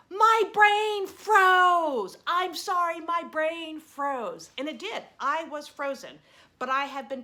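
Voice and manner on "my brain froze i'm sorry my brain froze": loud, high, very slow apology shouted after her; panicked shout. Retold or imitated. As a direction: yell the apology to the cyclist riding away. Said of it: "I said".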